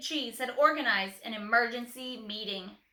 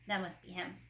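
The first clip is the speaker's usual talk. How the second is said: plain reading voice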